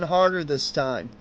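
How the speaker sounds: plain reading voice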